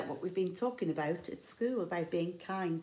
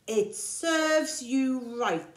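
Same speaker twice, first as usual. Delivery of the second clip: slow, louder, higher scolding; scolding animals; 6 semitones higher, 6 dB louder, much slower than usual. Acted. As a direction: tell him off slowly. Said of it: "they said".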